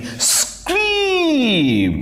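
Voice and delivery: high, stretched "scream"; imitated scream. Shown as a demonstration. Teacher demonstrating onomatopoeia, high drawn-out mock scream. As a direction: make the word sound like a scream, at normal volume. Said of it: "जैसे लिखा जाता है वैसे ही बोला जाता है".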